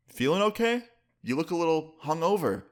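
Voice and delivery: high, leading question in an imitated dad voice; mock-concerned interrogation; suspicious probing. Dad probing a hungover guest.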